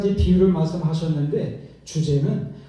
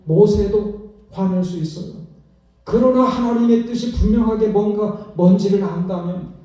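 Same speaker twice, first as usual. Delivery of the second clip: ordinary delivery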